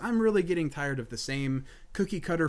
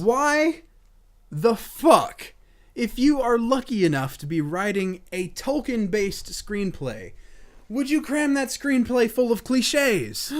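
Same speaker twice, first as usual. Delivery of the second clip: loud exasperated outburst, higher; angry rant; 8 semitones higher, 7 dB louder, slower than usual. Real, not acted. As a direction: blow up in frustration. Ranting outburst about cliches.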